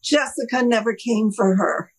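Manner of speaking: ordinary delivery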